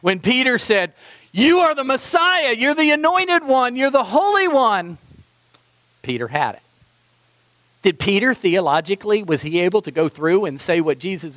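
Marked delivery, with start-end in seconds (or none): 1.3-5.0 much higher, louder exultant declaration; fervent proclamation. Acted. Peter declaring Jesus the Messiah, fervent. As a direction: proclaim it with awe and joy.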